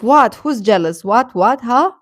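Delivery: ordinary talk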